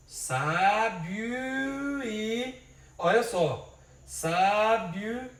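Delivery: sábio e stretched into one long gliding syllable; merged syllable demo. Shown as a demonstration. Teacher showing sábio e fused into one syllable. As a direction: draw sábio e out as a single beat. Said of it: "tudo isso vai em uma sílaba só".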